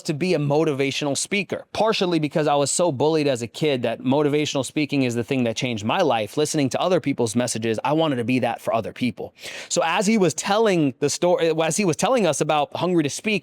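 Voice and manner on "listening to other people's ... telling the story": ordinary delivery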